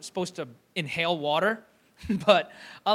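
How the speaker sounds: ordinary talk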